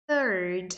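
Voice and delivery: "Third" starting on an airy TH, tongue between teeth; "Third" with a careful soft TH, not F; clear TH. Shown as a demonstration. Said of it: "o T H aqui".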